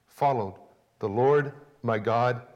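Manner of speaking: ordinary talk, nothing marked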